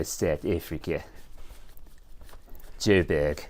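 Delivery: hammed-up South African accent, low; a bad South African accent. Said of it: "only way I can do a South African accent".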